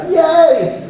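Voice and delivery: put-on high, gleeful kid cheer with a laugh; imitating happy son. Father quoting his son cheering at no school. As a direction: play the delighted little boy. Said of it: "And he's like".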